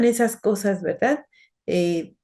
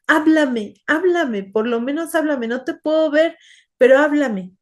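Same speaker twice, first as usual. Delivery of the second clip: put-on pleading girl; 6 semitones higher than usual. Marina begging her unseen mother to speak, higher and pleading. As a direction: beg mama to answer, a little scared. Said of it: "ella dijo algo muy importante".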